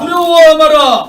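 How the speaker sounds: loud high slogan shout; roaring rallying speech. Real, not acted. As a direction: lead the chant. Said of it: "자, 제가 하겠습니다". (우렁찬 선창)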